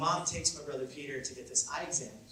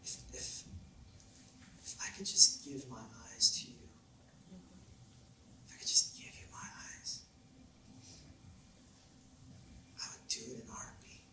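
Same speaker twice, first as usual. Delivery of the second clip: whispered, broken mom's plea; mother whispering to her son through tears; tearful whisper; 9 dB quieter, faster than usual. Retold or imitated. Weeping mom answers son.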